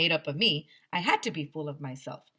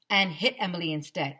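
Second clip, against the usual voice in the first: ordinary delivery, nothing marked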